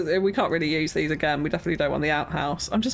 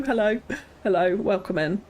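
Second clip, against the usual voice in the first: ordinary delivery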